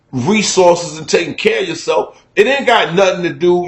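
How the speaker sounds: ordinary delivery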